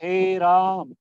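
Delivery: हे राम stretched and called out loud; पुकारता हुआ हे राम; drawn out call. Shown as a demonstration. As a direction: call हे राम, holding the vowels. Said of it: "जिसको काफी लंबा खींचा जाता है".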